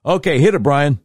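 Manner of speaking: calls the cue out high and loud. Real, not acted. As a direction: shout the cue to the producer. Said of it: "No, that's, that's not it".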